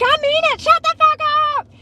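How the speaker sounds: very high, escalating mock screech. Girlfriend screaming as he keeps antagonizing, mock screech. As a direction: screech it like you've had enough. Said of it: "starts screaming louder".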